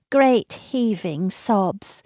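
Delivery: ordinary delivery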